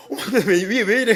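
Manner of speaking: high, loud mock-startled gasp of why; mock alarm. Worried about his own dry hands, loud mock-alarmed cry. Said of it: "이러고".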